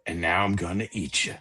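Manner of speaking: spooky voice. Acted. Spooky voice repeated in joke.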